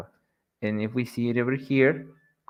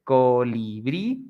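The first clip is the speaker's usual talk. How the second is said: "colibrí" slowly split as co-li-BRÍ; stress at the end; much slower than usual. Shown as a demonstration. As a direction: stretch "colibrí" and lift the final brí. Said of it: "the strong sound, it's at the end".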